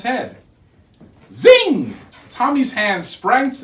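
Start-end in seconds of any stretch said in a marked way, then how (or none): 1.3-2.0 a high, loud "Zing!" like a spark; sharp zing sound. A narrator's reading. Narrator sounding the hat's shock, high and loud. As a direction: fling out the zap of magic.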